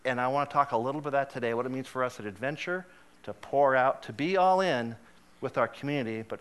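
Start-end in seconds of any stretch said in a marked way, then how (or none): none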